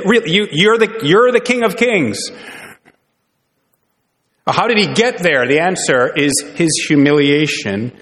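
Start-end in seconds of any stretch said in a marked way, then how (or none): 0.0-2.2 mock incredulity. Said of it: "we'd say".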